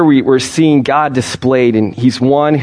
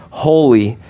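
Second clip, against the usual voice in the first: normal talking voice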